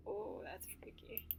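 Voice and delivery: spooked whisper. Real, not acted. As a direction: breathe it out, creeped out. Creeped out by dead classmates.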